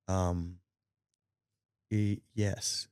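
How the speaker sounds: halting, careful acted-out yes; stall, stammer, then cautiously agree; softly hesitant, with long pauses; hesitant answer. Adam put on spot about wife.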